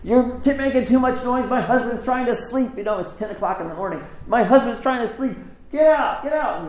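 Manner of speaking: high-pitched scolding tirade; yelling neighbor. Retold or imitated. Woman upstairs cursing the new buyers, yelling. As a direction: nag and shoo them off in a high voice. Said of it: "she starts yelling and cussing at them".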